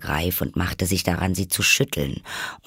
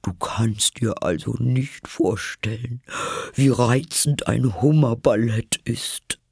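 breathy, plaintive creature voice; sighing, wistful enthusiasm; wistful Mock Turtle; 4 semitones higher than usual. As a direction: start airy, lift higher in rapture.

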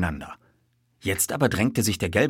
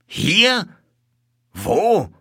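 high, loud, incredulous questions; startled; 13 semitones higher, 6 dB louder than usual. A narrator's reading. Colonel learning the culprit is here, startled. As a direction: snap out each word in astonishment.

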